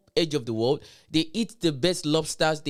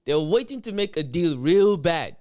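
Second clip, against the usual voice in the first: mock Trump; 4 semitones higher, 5 dB louder than usual. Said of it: "I wish I really know how to do the Trump".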